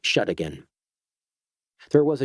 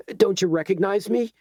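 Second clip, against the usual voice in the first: strained, tight, higher; 8 semitones higher than usual. A narrator's reading. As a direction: squeeze the voice tight. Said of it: "said Edgar in a tight voice".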